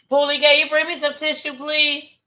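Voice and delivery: high, tearful plea through tears; choked-up ask for tissue; teary request. Real, not acted. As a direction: call out for tissues while crying. Crying, calls partner for tissue.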